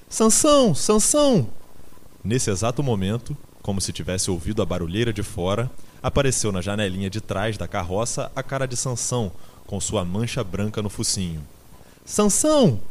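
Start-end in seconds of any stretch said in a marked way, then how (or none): 0.1-1.5 very high, loud cry; screamed name. A narrator's reading. Quitéria cries after Sansão, screaming high. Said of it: "gritou ela". 12.0-12.8 high, loud, desperate cry; desperate yell. A narrator's reading. Quitéria calls to Sansão in the van, yelling desperately. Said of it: "berrou Quitéria desesperadamente".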